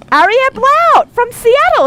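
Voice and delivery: high, slow, excited proclamation; winner announcement. Real, not acted. Radio host revealing the giveaway winner, excited announcement. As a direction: draw out the winner's name with excitement.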